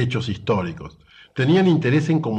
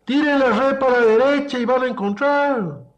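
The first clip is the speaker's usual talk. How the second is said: shouting; 13 semitones higher, 4 dB louder than usual. Retold or imitated. Man on the shore telling fishermen where to cast, shouting. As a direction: holler far up in pitch to carry to the boat. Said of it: "el tipo les vuelve a gritar".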